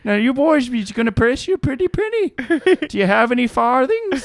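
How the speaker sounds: loud high Irish brogue; mock Irish accent. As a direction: sell the potato in stage Irish. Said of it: "an Irish accent".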